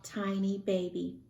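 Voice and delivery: plain reading voice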